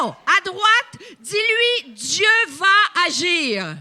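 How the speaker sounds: loud, high and slow on the last words; with conviction. Shown as a demonstration. Preacher showing the congregation how to declare it, loud. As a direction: shout it so the whole room believes it. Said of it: "Dis-lui avec conviction".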